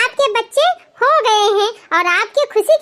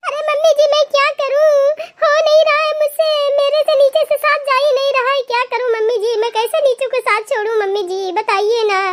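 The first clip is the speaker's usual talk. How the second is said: higher, strained pleading in labor; pleading in pain; 5 semitones higher than usual. Acted. Woman in labor asks mother-in-law how to push, pleading. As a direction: beg the mother-in-law for help through the pain.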